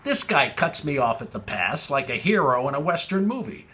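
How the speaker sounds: normal talking voice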